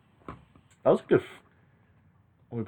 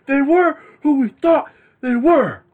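much higher, louder, slow yell; furious coach rant; 7 dB louder, slower than usual. Retold or imitated. Cohost reenacts the coach's furious rant, high and slow. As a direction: scream it at the press. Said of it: "He was pissed".

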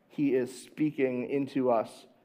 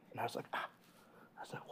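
telling it in a whisper; hushed whisper; 2 semitones lower, 21 dB quieter, much faster than usual. Retold or imitated. Father retelling the hunt, whispering. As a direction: keep it down like in the dark field. Father hushing daughter in dark field.

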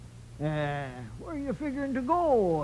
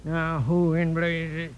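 ordinary talk, nothing marked

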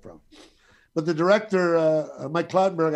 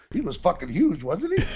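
laughing. Real, not acted. Laughing while recalling the huge guy.

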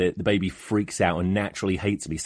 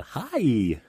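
put-on baby talk; 5 semitones higher than usual. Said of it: "like a".

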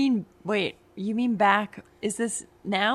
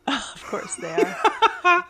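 laughing; 9 semitones higher, slower than usual. Real, not acted. Amused at twins news.

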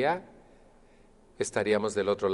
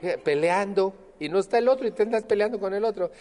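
laughing incredulously; 9 semitones higher, 4 dB louder than usual. Real, not acted. Laughs at people arguing alone.

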